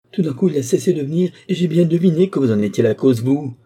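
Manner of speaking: fast, loud and high, bitter accusation; resentful outcry. A narrator's reading. Charity accusing her guardian of driving him away, crying out bitterly. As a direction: hurl the blame at him, rushing. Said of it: "s'écria-t-elle, avec toute la rancune irritée".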